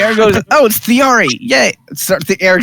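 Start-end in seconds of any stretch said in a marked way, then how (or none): 0.5-1.7 higher, pleased exclamation; light cheer on spotting a friend; mildly delighted, pitched up; pleased greeting. Acted. Eric acted greeting a liked crewmate.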